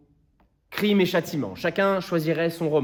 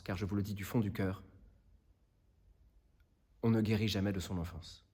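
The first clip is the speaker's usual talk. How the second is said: low, hushed plea sinking towards a near whisper; grave closing words with long pauses, fading away; solemn appeal; 3 semitones lower, 4 dB quieter than usual. Acted. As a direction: plead from the heart, softly and gravely. Lawyer's solemn final appeal to jury.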